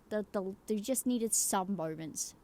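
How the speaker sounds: normal talking voice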